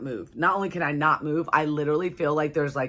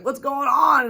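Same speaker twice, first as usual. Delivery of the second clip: higher, startled mock question; alarmed husband; 8 semitones higher, slower than usual. Husband to wife who just sat up, imitated high alarm. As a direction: imitate a husband jolted awake.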